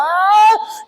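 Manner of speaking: short high mock wail; imitated high-pitched scream; young man's howl. Young man being straightened up, high wail. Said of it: "Y el muchacho es así".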